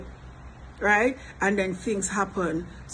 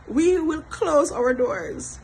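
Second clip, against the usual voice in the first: slow, high words with laughter in them; amused declaration; 6 semitones higher, much slower than usual. Real, not acted. Vlogger on closing the doors, laughingly emphatic. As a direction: stretch it out through a laugh.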